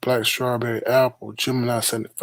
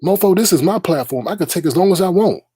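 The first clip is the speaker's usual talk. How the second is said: higher and louder, heated rant; fired-up, raised voice; 7 semitones higher, 4 dB louder than usual. Real, not acted. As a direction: tell him off with rising anger. Angry rant at D-Spot.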